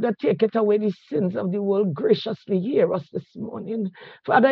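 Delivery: ordinary delivery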